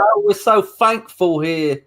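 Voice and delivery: THANKFUL said slowly with F for TH; imitated British THANKFUL starting with F. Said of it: "it's F in some places over there".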